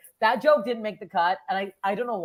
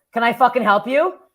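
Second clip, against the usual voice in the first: raised, louder mock challenge; retold confrontational challenge; 5 semitones higher, 7 dB louder than usual. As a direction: challenge a stranger, replaying it. Wary challenge to approaching stranger.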